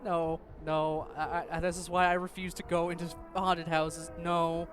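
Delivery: frightened protest. Real, not acted. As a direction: refuse in a fright. Recovering from the scare.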